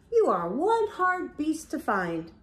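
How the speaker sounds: ordinary delivery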